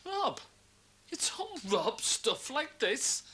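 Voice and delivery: a sharp high yelp of offended disbelief; mock outrage. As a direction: throw the word back as if insulted. Indignant denial of theft.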